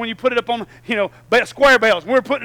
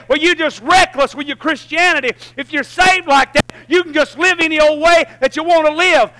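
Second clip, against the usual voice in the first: higher and louder, pounding the point home; full-force preaching; 8 semitones higher, 11 dB louder than usual. Acted. Pastor preaching the cross to the church at full force. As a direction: preach it out with everything.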